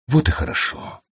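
hushed, breath-only approval; weary relief. A narrator's reading. Tired sorcerer to his new slave, hushed relief. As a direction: let it out with hardly any voice.

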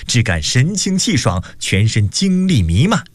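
ordinary delivery, nothing marked

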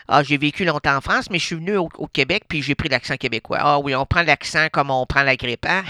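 normal talking voice

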